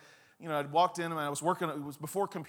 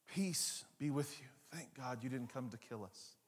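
calming voice; 6 semitones lower, 12 dB quieter than usual. Acted. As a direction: ease their fear with each word. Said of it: "That's a calming voice".

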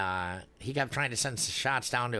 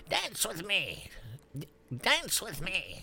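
put-on old man voice; 7 semitones higher, slower than usual. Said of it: "he would always be like".

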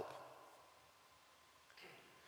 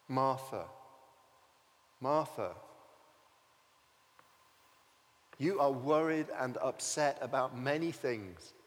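tender Jesus; 4 semitones lower than usual. Acted. Jesus tenderly answering frantic Martha, gentle and lower. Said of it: "such tenderness in his voice".

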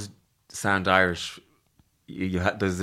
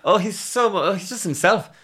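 higher, gushing imitation of admirers; mock gushing fan; 11 semitones higher, 4 dB louder than usual. Mocking fans who praise a performer, high gushing voice. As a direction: coo it like a dazzled fan, much higher. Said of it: "the people that we laud".

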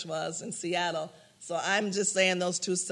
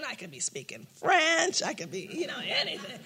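high, amused musing; light laughing speculation; mocking her own skepticism; playful doubt; 6 semitones higher than usual. Retold skeptical thoughts.

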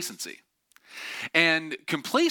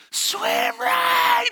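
very high, loud acted scream; piercingly high warning yell; 6 dB louder than usual. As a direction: scream urgently to someone in the water. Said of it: "screaming to her".

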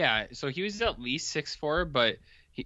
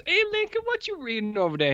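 mock townsfolk call; faster than usual. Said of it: "And then everyone goes".

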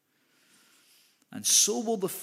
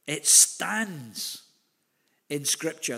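"stands" hit hard and louder, then a pause; stress on stands; 3 dB louder, much slower than usual. Shown as a demonstration. Preacher savouring the verse's emphasis, "stands" punched before a pause. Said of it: "I love that emphasis".

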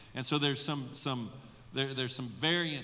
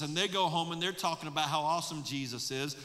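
ordinary talk

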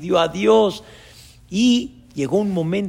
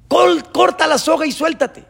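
high, loud divine order; acted shout from above; forceful command; 12 semitones higher, 7 dB louder than usual. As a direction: call out the order urgently. God's command repeated forcefully.

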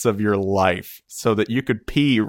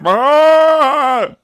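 very loud, high wail; mock agonized scream from a toilet stall; drawn-out, piercing mock howl; imitated scream of pain; 11 dB louder than usual.

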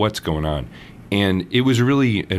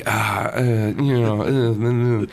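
slow, halting, awkward stammer; slow tongue-tied stammer; awkward fumbling; 4 semitones higher, slower than usual. Retold or imitated. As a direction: fumble for words. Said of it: "fumbling around and feeling awkward".

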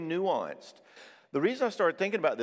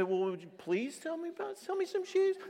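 mock pleading. Insulted customer begging shoe seller, mock pleading. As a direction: plead meekly in a raised pitch.

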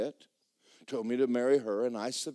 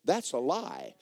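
normal talking voice

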